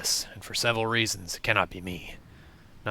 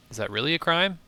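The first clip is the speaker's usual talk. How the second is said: incredulous; 7 semitones higher than usual. Real, not acted. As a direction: pitch jumps up in disbelief. Player disputes the crime.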